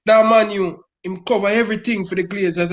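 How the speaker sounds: ordinary talk, nothing marked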